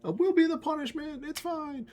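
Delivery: very high, cartoonish giving-up voice; little vegetables shrugging off the fight as they run; fleeing broccolis. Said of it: "they're like".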